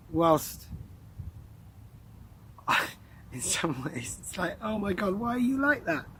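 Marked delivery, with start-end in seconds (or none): 4.6-6.1 high-pitched exasperated reaction; acted incredulous question; mock exasperation. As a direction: exclaim it higher, as if baffled by someone. Voices a reaction to neighbours.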